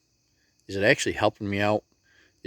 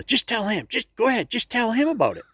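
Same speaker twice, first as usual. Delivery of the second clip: put-on high, pushy old lady voice; a little old lady nudging her friend to speak up; old lady urging; 12 semitones higher than usual. Old lady pushing her friend to tell him, high and urging.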